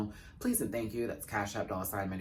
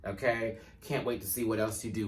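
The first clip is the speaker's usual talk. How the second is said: normal talking voice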